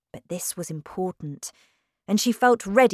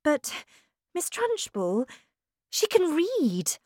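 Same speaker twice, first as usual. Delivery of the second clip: much higher, earnest, pleading; eager young teacher; 11 semitones higher than usual. A narrator's reading. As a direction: insist on the girl's gifts. Miss Honey voiced in story.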